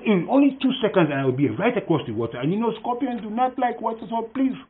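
high, insistent begging; pleading scorpion. Acted. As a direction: promise and beg in a high, eager voice. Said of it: "And the scorpion said".

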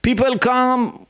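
high, urgent sermon voice; preaching at full force. Acted. Preacher warns the listeners about troublemakers, urgent and high. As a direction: press the warning home to the crowd.

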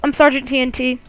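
high, fast youtuber sign-off; upbeat outro. Acted. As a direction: rattle off the goodbye cheerily.

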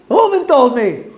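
high-pitched mock outcry; insistent retort. Shimon blaming Reuven to Levi, insistent mock outcry.

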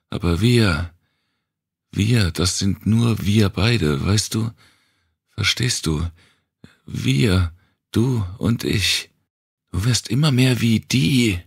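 cling to her with rising desperation; desperate plea. Acted. Wanda begs the girl, voice climbing desperately.

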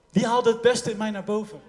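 ordinary delivery

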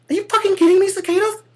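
high, loud falsetto outrage; outraged disbelief. Pretend. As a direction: squeal at the bugs in disbelief. Shocked person facing the cicada.

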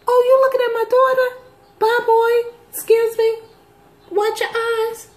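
high, fast, sassy telling-off; mock outraged mom. Mom telling off a man eyeing her daughter, high and fast. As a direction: chase the man off with sass. Retold scolding of staring man.

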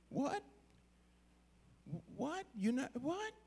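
high, hushed disbelief with rising ends; incredulous. Acted. Mordecai looking at Esther after her excuse, incredulous. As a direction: question it softly, unable to believe it.

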